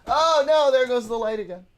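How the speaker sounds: loud, high oh no; loud laughing dismay. Real, not acted. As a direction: react loudly as the light falls. Dog knocks over light.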